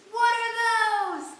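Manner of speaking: high, very loud, drawn-out yell; stretched-out mock-outraged shout; shouted meme catchphrases. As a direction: bellow it like a viral catchphrase.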